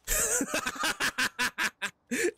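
bursting, high-pitched laugh. Real, not acted. Player laughing at Gaz, high burst of laughter.

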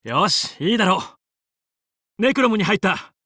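normal talking voice

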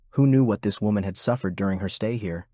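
usual speaking voice

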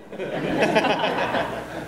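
soft breathy laugh; laughter. Real, not acted. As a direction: let a real laugh slip out. Laughs after demo.

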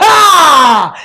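long, loud wail of dismay; alarmed cry. Acted. Alarmed that neighbor is finished.

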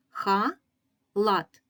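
"Ха-лат" in two detached, firm syllables, KHA and LAT. Shown as a demonstration. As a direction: break "Ха-лат" with a gap between the syllables. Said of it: "Перший склад".

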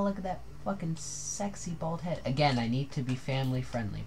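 usual speaking voice

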